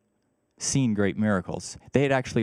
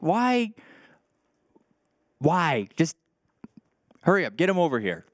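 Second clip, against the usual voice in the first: exasperated, pitched high on the first why; impatient, fed-up demand to hurry; frustrated Jesus; 7 semitones higher than usual. Retold or imitated. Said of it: "he almost got rather frustrated".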